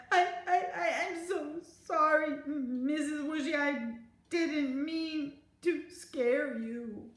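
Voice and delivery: high, stammering, tearful apology; sobbing child. A narrator's reading. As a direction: apologise between sobs, slowing to drawn-out words. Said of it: "I said between sobs".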